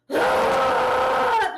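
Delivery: very high, loud shriek; pent-up rage scream. Retold or imitated. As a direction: explode in a mock shriek of rage. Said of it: "literally exploded with rage".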